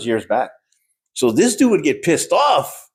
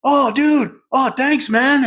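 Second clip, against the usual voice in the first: higher, delighted thanks; grateful young guy; 12 semitones higher, much slower than usual. Retold or imitated. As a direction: imitate him, pleased and eager. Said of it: "and he goes".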